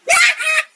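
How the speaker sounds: squeaky high-pitched laugh; helium laugh. Real, not acted. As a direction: cackle through helium, loud. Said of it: "Tourette's on helium".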